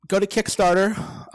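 usual speaking voice

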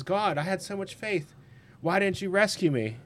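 a touch of wounded complaint; plaintive man to God. Retold or imitated. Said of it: "he says".

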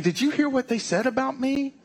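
high, gossipy little critter voice; gossiping animal. Pretend. Pastor voicing an imagined zoo chimp gossiping, high and silly. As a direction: squeak it out like a chimp spreading gossip.

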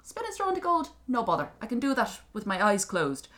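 high, cocky imp voice; boastful little creature. Acted. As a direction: brag breezily like a leprechaun. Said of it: "the little thing said".

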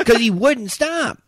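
loud, high, drawn-out complaint; exasperated. Real, not acted. Player venting to co-host about the coach, loud drawn-out exasperation. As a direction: stretch it out in high-pitched exasperation.

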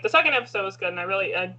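normal talking voice

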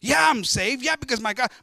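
loud, high, huffy comeback; mock indignant retort. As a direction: snap back as if wronged. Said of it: "No, he doesn't say that".

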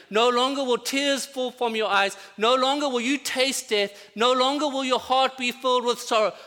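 loud, high, triumphant declaration; the Father telling his child the suffering is over; voice of God the Father. Pretend. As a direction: proclaim it like the Father ending all sorrow. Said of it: "he's gonna say to us".

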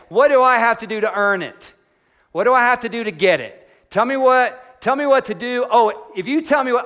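mock the man's eager, can-do demand. Voicing the rich man's eager demand.

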